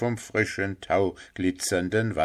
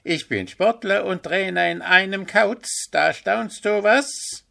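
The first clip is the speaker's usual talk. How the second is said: high, proud, show-off call; boastful owl; 11 semitones higher, 5 dB louder than usual. A narrator's reading. Owl Willi brags to grumpy Puh, high boastful shout. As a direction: crow about being athlete and coach.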